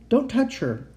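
high, urgent command; stern order. A narrator's reading. Uncle stops the boy nearing the captive, ordering. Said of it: "Uncle Victor ordered him".

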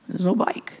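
hushed whisper; boy whispering at the empty chair; whispered letdown. Retold or imitated. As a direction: breathe it out in quiet disappointment.

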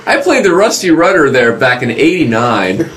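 excited. Real, not acted. Excited aside, laughing.

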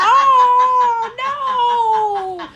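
loud, high drawn-out wail; mock horror. Told that she farts in her sleep, mock horror. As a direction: cry out in exaggerated dismay.

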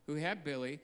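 ordinary talk, nothing marked

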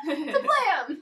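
laughing explosion noise, loud; spoken sound effects. Pretend. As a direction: shout a cartoon boom.

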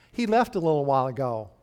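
ordinary delivery

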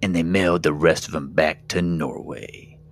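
slow, booming trailer announcer; horror trailer voice. Acted. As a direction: boom it like a movie trailer. Parody movie trailer over music.

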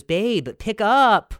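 demanding call. Retold or imitated. Girlfriend calling the fake number, drawn-out demand. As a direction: stretch it out, pestering. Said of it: "and she goes".

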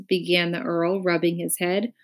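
plain reading voice

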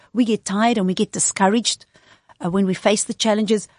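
ordinary delivery

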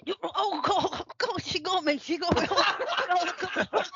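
high, laughing exclamations; flustered laughing gasps; laughing shock. Real, not acted. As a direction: caught out and cracking up. Caught out, laughing.

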